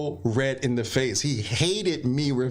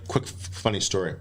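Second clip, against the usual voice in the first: ordinary talk, nothing marked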